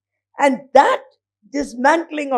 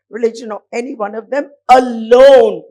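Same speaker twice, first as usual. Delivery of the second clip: "alone" pushed out louder at the end; stress on alone; 7 dB louder than usual. Real, not acted. Reading the article's key word to the audience with heavy stress on "alone". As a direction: lean hard on the word "alone". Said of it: "Emphasis on the word alone".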